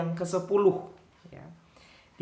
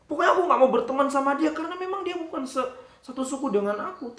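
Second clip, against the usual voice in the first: put-on stubborn child; 8 semitones higher, faster than usual. Child insists, refusing.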